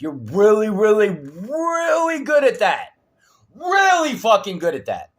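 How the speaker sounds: loud, high, drawn-out REALLY; mock praise. Real, not acted. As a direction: lay the praise on with a heated shout. Heated sarcastic rant at investigators.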